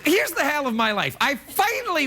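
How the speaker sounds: exasperated outburst. Real, not acted. Outburst about sidekick.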